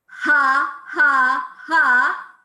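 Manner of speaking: three loud punched belly-laugh has. Shown as a demonstration. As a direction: pump out each ha from the diaphragm. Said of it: "Number four".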